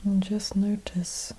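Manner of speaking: guided meditation. Acted. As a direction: gently guide them inward, unhurried and even, leaving space after each phrase.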